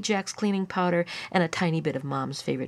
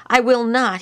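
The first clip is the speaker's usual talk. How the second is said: put-on loud, higher indignant child; 6 semitones higher, 9 dB louder than usual. A narrator's reading. Lyle refusing to stay with Melody, indignant. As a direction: refuse loudly, offended. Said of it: "he said indignantly".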